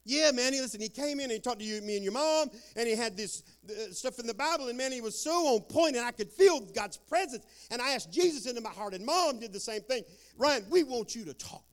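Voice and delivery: high and loud, bubbling over with joy; a newly saved father who can't contain himself; excited dad. Retold or imitated. Father tells his son about the preacher, high and loud.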